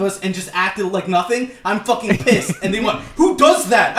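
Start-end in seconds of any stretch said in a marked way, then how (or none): 3.2-4.0 high, loud retold challenge; retold yelling. Line-cutters yelling back at him at the zoo, indignant mock yell. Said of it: "like, yelling at us".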